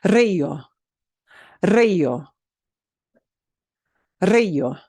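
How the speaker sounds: río with a trilled initial r; strong trill. Shown as a demonstration. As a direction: roll the opening r of río. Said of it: "so it gets a strong trill".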